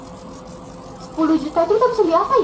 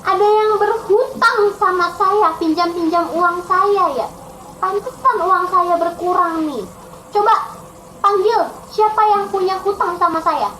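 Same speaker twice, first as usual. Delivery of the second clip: higher, demanding king's voice; stern creditor king; 3 semitones higher than usual. Acted. King checking his books and calling for his debtor, demanding. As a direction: royal demand, pitched up. Narrator acts king finding debts.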